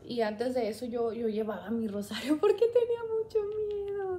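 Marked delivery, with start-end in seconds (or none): 2.4-4.2 higher, laughing through the memory of fear; laughing nervously. Real, not acted. Telling viewers about her fear, laughing nervously. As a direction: laugh it off while admitting the fear.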